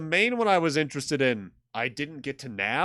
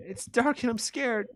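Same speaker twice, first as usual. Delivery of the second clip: put-on high, slow frightened child voice; scared Melissa; 10 semitones higher than usual. Girl hiding in the dark room, slow high scared voice. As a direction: drag it out, small and afraid. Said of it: "That's Melissa's voice".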